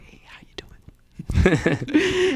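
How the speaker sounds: slow, high whispered greeting; knowing whisper. Retold or imitated. Coach greets his player, a slow knowing whisper. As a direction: greet like you share a secret.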